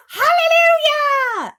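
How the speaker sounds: very high, loud, drawn-out cry; ecstatic shout. A narrator's reading. Aunt dances round the tree, shouting for joy. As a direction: shout it to the heavens. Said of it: "Aunt Spiker shouted".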